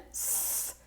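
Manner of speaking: a long unvoiced hiss, no voice; voiceless hiss. Shown as a demonstration. Teacher shows the hiss for S. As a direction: breathe a soft steady S. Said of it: "Same air is coming out like this".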